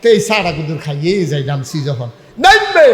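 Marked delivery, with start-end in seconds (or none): 2.4-2.9 high, loud bus-stop call; acted shout. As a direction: shout out that someone is getting off. Bus passenger calls to get off.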